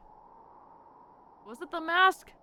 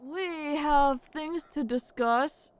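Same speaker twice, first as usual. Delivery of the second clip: slow, higher-pitched, drawn-out delivery; deliberately slow and raised in pitch; slow announcement; 6 semitones higher, much slower than usual. Acted. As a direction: stretch the words out portentously. Player announces business to game character.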